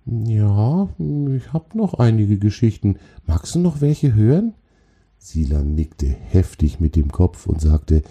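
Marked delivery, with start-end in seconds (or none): 0.0-4.5 lightly raised troll voice; friendly troll. Acted. Troll offers the spider more stories, friendly. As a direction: offer more in a higher, questioning character voice. Troll figure speaks in character.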